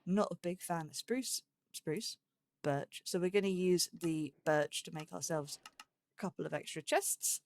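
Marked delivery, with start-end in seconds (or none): none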